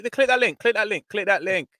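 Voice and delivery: high-pitched, insistent repeats; urgent prodding. Real, not acted. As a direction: hurry them along impatiently. Urging co-host to click a link.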